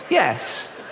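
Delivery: ordinary delivery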